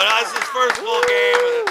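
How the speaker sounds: high, loud and slow, laughing; excited correction. Retold or imitated. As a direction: correct the ref with mock outrage. Retelling talk with the referee.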